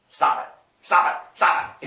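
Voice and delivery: loud, sharp repeated order to himself; stern self-scolding. Retold or imitated. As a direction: snap at yourself to stop. Scolding himself to stop anger.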